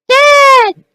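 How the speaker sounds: put-on very high, loud kid's cry; child's shout. Boy calling his dad after the shovel hit, very high shout. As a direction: shriek for your dad like a small boy.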